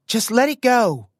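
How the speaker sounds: high, loud yell. Acted. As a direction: shout across the scuffle. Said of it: "Lila yelled".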